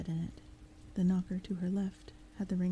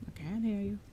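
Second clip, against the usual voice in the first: matter-of-fact. Acted. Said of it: "in a matter-of-fact voice".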